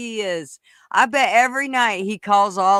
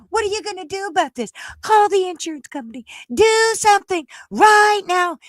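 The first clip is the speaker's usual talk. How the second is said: high, rising to a loud demand; mock the shrill woman yelling at the governor; escalating imitation of an angry resident; mimicking angry lady; 10 semitones higher, 3 dB louder than usual. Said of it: "She's like".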